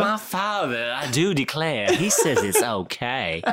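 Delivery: lightly mocking Southern belle drawl, laughing. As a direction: play a prim Southern belle citing her father. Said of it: "she's a Southern belle".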